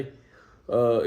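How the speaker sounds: ordinary talk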